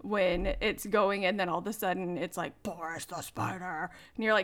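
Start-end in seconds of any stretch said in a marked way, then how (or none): 2.6-3.9 lower, softer mock-spooky singer; scary gruff voice. Imitating the line that comes all of a sudden, low gruff voice. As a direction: slip into a low, gruff voice, kept small. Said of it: "scary, gruff voice".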